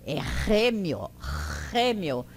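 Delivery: RÊMIO with a strong throaty H at the start; guttural H. Shown as a demonstration. Esperanto teacher shows the throat sound, guttural RÊMIO. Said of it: "é gutural".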